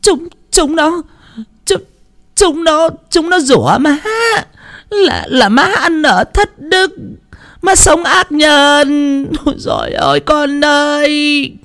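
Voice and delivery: high, slow fake wailing with sobs. Mother wailing to her son, high and sobbing. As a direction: wail theatrically to her son. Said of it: "vật vã than khóc".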